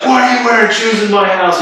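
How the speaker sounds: high-pitched mock angry yell; imitated outraged shout; retold screaming. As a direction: confront him high and indignant. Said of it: "who starts screaming at me".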